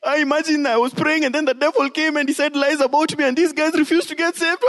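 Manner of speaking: high, fast whining excuse voice; preacher mocks believers excusing themselves to God; whiny excuse. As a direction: whine out a lame excuse. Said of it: "We don't get to say".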